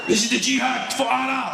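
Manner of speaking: high, loud mock battle cry; mock jihadi cry. Mocking an imagined bomber, a high mock battle cry. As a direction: ham up a fanatic's cry, pitched high.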